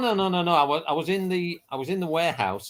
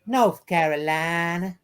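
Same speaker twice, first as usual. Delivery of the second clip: slow drawled North Carolina accent; much slower than usual. Shown as a demonstration. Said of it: "I'm gonna do North Carolina now".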